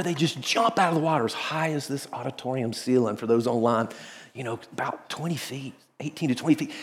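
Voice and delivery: ordinary talk, nothing marked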